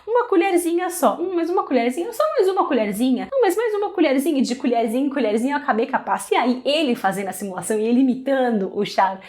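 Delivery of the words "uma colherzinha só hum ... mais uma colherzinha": higher, playful coaxing voice; hammed-up bargaining with herself over one more spoonful; self-bargaining mock voice. Vlogger reenacting herself bargaining with the jar, playful mock coaxing. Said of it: "eu ficava assim com a pasta".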